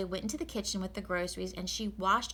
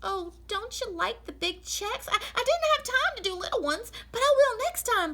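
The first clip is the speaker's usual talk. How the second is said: much higher, eager to please, getting louder; Amelia Bedelia; 5 dB louder than usual. Acted. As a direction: earnestly promise the master. Amelia answers Mr Rogers.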